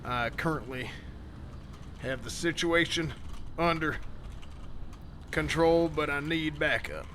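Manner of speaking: calmly key the mic and report in; radio call. Acted. Sheriff reports a kidnapping at Burger Shot over radio, low report.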